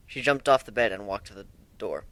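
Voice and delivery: ordinary delivery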